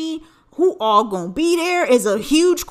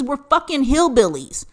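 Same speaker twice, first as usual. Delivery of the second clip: ordinary talk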